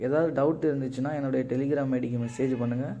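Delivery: normal talking voice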